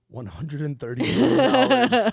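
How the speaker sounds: dramatic emphasis. Acted. Reading Wikipedia fact dramatically.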